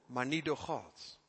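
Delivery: ordinary delivery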